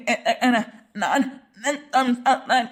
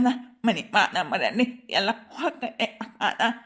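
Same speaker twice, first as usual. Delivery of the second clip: comic stammer; 5 semitones lower than usual. Acted. Flustered husband stammers a plea against the police complaint. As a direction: lower voice, stuck on first sounds before the words come.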